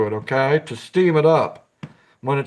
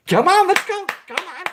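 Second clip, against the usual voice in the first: rising, much higher excited dog-owner coaxing; talking to a dog. Retold or imitated. Imitating an owner coaxing his dog outside, rising to a high squeal. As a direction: coax the dog out, building up high and eager. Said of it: "It's like letting the dog".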